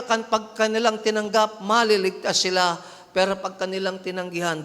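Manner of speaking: ordinary talk, nothing marked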